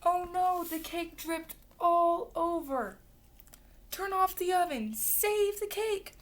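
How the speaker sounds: high, drawn-out cry of alarm; panicked storybook exclamation; alarmed monkeys. A narrator's reading. Monkeys panic over burning cake.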